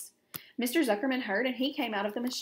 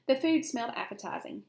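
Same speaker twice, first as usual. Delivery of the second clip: ordinary delivery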